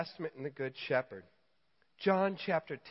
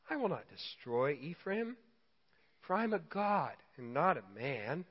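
scripture reading; 2 semitones lower, 3 dB quieter than usual. Acted. Reads scripture softly.

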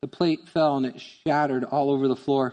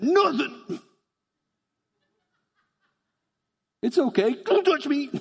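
upset little daughter; 11 semitones higher than usual. Retold or imitated. Upset daughter after the teacup breaks.

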